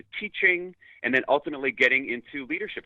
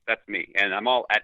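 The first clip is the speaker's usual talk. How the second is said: normal talking voice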